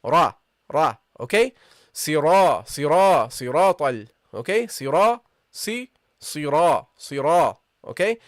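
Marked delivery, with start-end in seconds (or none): 0.0-1.0 "Ra" full and heavy, deep in the mouth. Shown as a demonstration. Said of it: "heavily pronounced". 1.9-4.1 "sira" with a heavy round "ra". Shown as a demonstration. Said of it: "It's heavily pronounced". 4.7-7.5 "sira" drawn out slowly with a heavy "ra". Shown as a demonstration. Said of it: "It's heavily pronounced".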